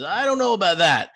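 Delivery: a hint of a hobgoblin pushing back; defiant hobgoblin. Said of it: "the hobgoblin says".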